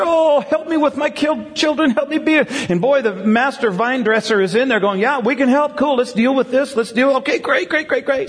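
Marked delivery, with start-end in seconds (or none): 0.0-2.5 high-pitched imitation of eager pleading; acted high plea for help; mock pleading. Acting parents begging for help. 5.0-8.3 imitated eager helper. Acting the helper's eager reply.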